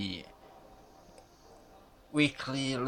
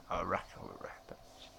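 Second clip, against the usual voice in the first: low, near-whispered correction; muttered aside; 4 semitones lower, 9 dB quieter than usual. Real, not acted. Correcting a misread line of narration, muttered aside. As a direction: drop your voice almost to a whisper.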